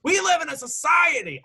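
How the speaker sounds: high yelled meme line; meme shout. Pretend. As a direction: belt out the meme. Meme quote shouted back.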